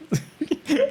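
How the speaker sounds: short high giggle; a quick giggle escapes; short laugh. Real, not acted. Distracted by the drawing, short high giggle.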